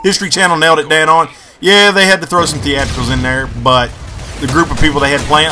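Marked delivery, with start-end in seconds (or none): none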